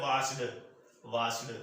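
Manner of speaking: washed said as wa-shed, two syllables with a voiced d; wrong pronunciation. Shown as a demonstration. Teacher demonstrating the students' mistaken washed with a spoken extra syllable. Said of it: "ਇਹਨੂੰ ਕਈ ਬੱਚੇ ਜਿਹੜਾ ਬੋਲਦੇ ਨੇ".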